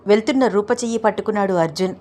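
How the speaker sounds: ordinary delivery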